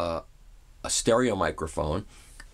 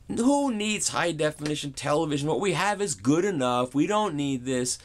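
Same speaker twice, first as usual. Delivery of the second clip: higher, scoffing radio host; mock Howard Stern; 6 semitones higher than usual. Howard Stern scoffs at HDTV talk, mocking and pitched up. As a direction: dismiss it like a brash host.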